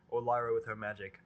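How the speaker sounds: usual speaking voice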